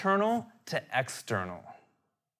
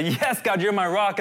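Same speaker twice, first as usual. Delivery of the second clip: raised, emphatic cry of faith; confident declaration; 6 semitones higher, 5 dB louder than usual. Acted. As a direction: declare it to God, pitched up and louder. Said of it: "We're gonna say".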